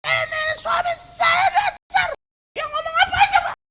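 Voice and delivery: hammed-up raging wife yelling at the pastor; angry wife. Istri marah-marah di rumah pendeta. (istri marah berteriak melengking)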